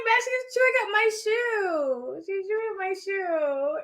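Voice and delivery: a lightly voiced high complaint, slow; imitating a viewer. Quoting a viewer's comment about the puppy, lightly high. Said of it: "And she's like".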